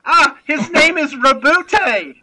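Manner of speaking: loud, high, slow mock outrage at the name; mock indignant correction. Correcting the host's pronunciation, with loud mock outrage. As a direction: drag it out in fake indignation.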